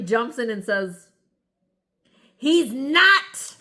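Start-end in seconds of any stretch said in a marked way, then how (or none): 2.4-3.6 high, loud mocking retort; mock snappy denial. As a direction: snap it back like the witness. Mocking her quick denial.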